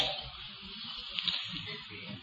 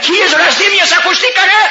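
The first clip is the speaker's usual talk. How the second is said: preaching shout; 12 semitones higher than usual. Real, not acted. Preacher decries builders' cursing.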